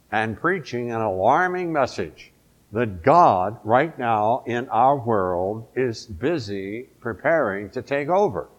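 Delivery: ordinary talk, nothing marked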